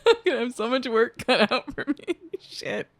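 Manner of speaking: words breaking into giggles; laughing. Real, not acted. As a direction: crack up at your own slip. Cracks up after a slip.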